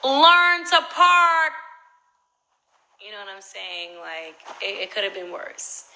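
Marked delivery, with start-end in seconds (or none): 0.0-1.5 high, slow, full-volume bellow; loud mock yell of the note; all-caps shout. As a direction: shout out the note, stretching each word. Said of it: "in all caps".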